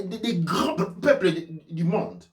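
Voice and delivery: ordinary talk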